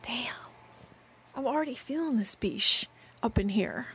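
hushed whispered aside; whispering. Real, not acted. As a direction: whisper it under your breath. Aside, voice dropped.

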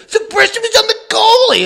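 mock indignant protest. Imitating a mad player to the ref, high indignant squawk. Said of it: "That always gets people mad".

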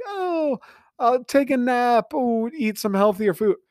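high, airy mock-naive voice; mock the clueless optimist. Mocking voice of the naive view.

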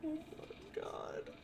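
slow, very soft whispered disbelief; appalled whisper. Real, not acted. Listener reacts to a crude retold joke, whispering. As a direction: whisper it slowly, stunned by the joke.

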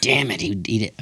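usual speaking voice

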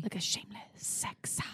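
breathy whispered jingle line. Shown as a demonstration. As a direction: whisper it like a secret. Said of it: "Why do you think the jingle would be?".

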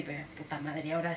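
low, hushed worrying to herself; soft, uneasy inner monologue; retold worry. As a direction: swear under your breath, imitating a scared moment. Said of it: "sí me asusté".

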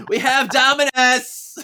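high, loud announcing holler; hammed-up call. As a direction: shout the news across a hall. Paige calling out to Tribunal.

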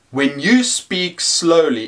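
ordinary delivery

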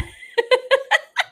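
high, loud laugh; burst of laughter. Real, not acted. Cracking up at their own orgy joke, high laughter.

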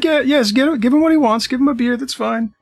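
high, nervous pleading; afraid patrons. Acted. Frightened patrons giving in to the half-orc, high nervous pleading. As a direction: placate him quickly, high and scared. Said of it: "people are obviously afraid".

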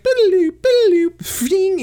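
very high, loud silly warp sound effect; mock teleport noise. Imitating the Ascian teleporting in, high and loud. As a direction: chirp out a cartoon teleport noise. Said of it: "and then the Ascian's like".

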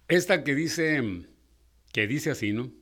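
ordinary talk

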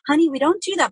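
sweet teacher voice. Retold or imitated. Mother acting like a school teacher to her kid, sugary teacher voice. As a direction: play the patient teacher, sugary and bright. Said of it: "act like I'm a school teacher and be like".

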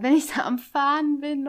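imitating an indignant driver. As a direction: raise the voice in mock protest. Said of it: "richtig mit erhobener Stimme".

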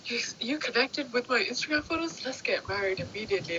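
hammed-up breathless delight; mock gushing. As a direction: gush like an instantly smitten fan. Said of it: "expect us to just be like".

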